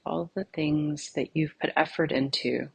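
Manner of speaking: soft, unhurried meditation voice with long pauses; guided meditation. Acted. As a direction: gently lead them inward, phrase by phrase, leaving silence between. Leads a guided meditation exercise.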